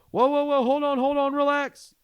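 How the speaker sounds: hammed-up alarmed cop yelling; cop's shouted command. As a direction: shout to halt someone rushing at you. Same cop yell repeated.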